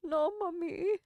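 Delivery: high, put-on German accent for the cat; little German accent. Owner voicing her cat answering in a German accent. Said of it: "give them little German accents".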